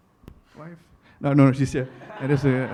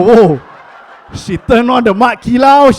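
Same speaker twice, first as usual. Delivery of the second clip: usual speaking voice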